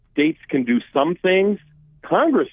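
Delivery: normal talking voice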